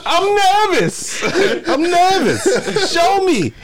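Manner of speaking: slow, loud squeaky voice; high nervous falsetto. Acted. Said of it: "can do voices so good".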